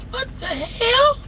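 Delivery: very high, slow falsetto squeal; hammed-up squeaky bewilderment; mock disbelief. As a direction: stretch it out in a tiny high voice. Retelling his shock at the mother.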